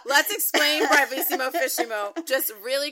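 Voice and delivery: loud, high talk through laughter; real laughter spilling into the words; laughing. Real, not acted. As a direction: start explaining while still cracking up. Still laughing while starting to explain.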